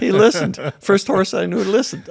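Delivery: sarcastic mock amazement, laughing; teasing disbelief with a laugh. Real, not acted. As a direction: feign being impressed, chuckling through it. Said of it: "I'm being sarcastic".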